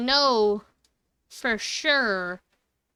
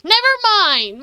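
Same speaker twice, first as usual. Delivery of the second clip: relieved shout; 10 semitones higher, 9 dB louder than usual. Real, not acted. Relief after false alarm.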